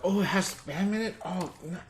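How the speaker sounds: high, dismayed squeal; disgusted. Retold or imitated. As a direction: recoil at the news of spam. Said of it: "some people are like".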